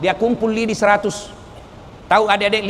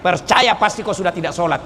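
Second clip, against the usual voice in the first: ordinary delivery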